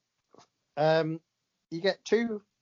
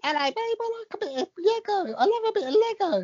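David Beckham impression. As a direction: put on a gentle, high Beckham voice. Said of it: "What is that voice?".

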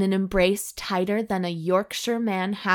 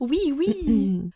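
high, put-on French hostess voice; mock-seductive French accent; alluring French accent; 7 semitones higher than usual. A narrator's reading. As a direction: pitch it up as a flirty French hostess. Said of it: "in a seductively alluring French accent".

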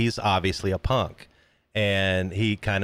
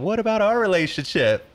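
lightly voiced girlfriend, pitched higher; imitating the girlfriend; 9 semitones higher than usual. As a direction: quote the girlfriend in a raised pitch. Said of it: "his girl says to him".

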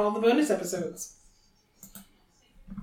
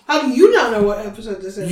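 fast, loud comeback at usual pitch; loud retort; 7 dB louder, faster than usual. Pretend. Host turning the question back, loud retort. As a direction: throw it back quick and loud.

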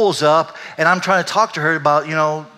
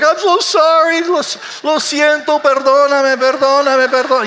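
hammed-up wailing apology, very high and loud; mock crying; 14 semitones higher, 9 dB louder than usual. Men in church begging forgiveness, exaggerated loud sobbing wail. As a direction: bawl for forgiveness like a comic imitation of weeping men. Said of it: "some guys are crying".

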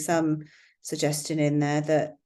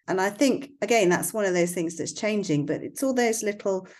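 ordinary delivery

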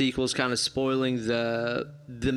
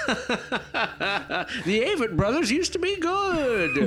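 high, slow snooty sigh; snobby hipster voice; 10 semitones higher, much slower than usual. Retold or imitated. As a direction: sniff it like a jaded hipster. Mocking hipster voice.

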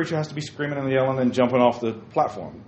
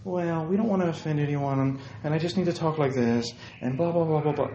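softer meek put-on voice; mock timid preacher; 6 dB quieter than usual. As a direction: mimic a timid pacified preacher. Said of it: "so pacified for everybody".

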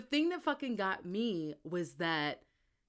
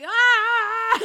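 high, loud fangirl shriek; mock scream; 10 semitones higher, 11 dB louder than usual. Reader reacts to Reese's flirting, high mock scream. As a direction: let out a comic scream.

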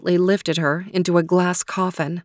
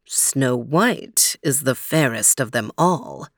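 low, slow otherworldly mirror voice; magic mirror voice; 5 semitones lower, slower than usual. Acted. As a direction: intone it like an ancient spirit. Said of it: "said the magic mirror".

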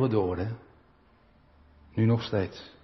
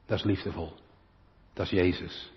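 tender; 6 semitones lower, 6 dB quieter than usual. Acted. Tender close on Jesus' love.

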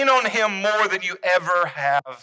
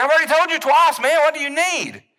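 exasperated outburst, higher and louder; irritated retort, pitched up and loud; frustrated; 9 semitones higher, 4 dB louder than usual. Retold or imitated. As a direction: snap back, fed up with being asked. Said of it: "the scripture actually says he got frustrated".

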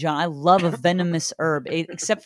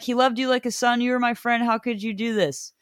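raised-pitch mock plea; 6 semitones higher than usual. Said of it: "She's like".

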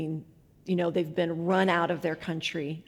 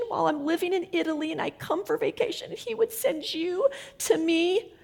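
high tearful wonder, retold; soft, high voice brimming with emotion; crying; 9 semitones higher, 3 dB quieter than usual. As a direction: relay her overwhelmed gratitude through tears. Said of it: "she's crying and crying".

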